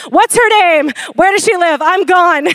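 hammed-up urgent demands; fixer parent. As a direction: play the protective parent storming off. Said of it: "There's the fixer right there".

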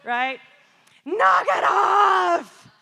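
put-on roaring, high full-volume shout at kids; beast-like yell. Mom storming out of her room at squabbling kids, beast-like yell. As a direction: drag out the words in an exasperated bellow. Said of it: "I'm like a beast".

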